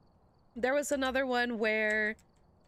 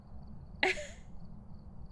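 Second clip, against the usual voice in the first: breathless silent laugh; 9 dB quieter than usual. Real, not acted. Laughing at the wig answer.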